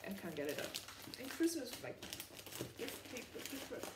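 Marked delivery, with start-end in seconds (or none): none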